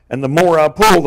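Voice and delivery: ordinary delivery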